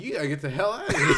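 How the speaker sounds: retold line rushed through real laughter; laughing. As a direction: blurt it out while cracking up. Cracking up at his own story.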